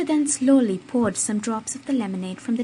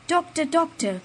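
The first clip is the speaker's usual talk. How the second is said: desperate higher cry; pleading cry, higher and louder; 8 semitones higher, 5 dB louder than usual. A narrator's reading. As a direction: call the doctor in panic. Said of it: "cried Barrois".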